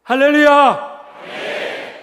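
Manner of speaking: loud, drawn-out sermon shout; preacher's exclamation. Real, not acted. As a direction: call it out long to the congregation. (길게 외치는 설교조)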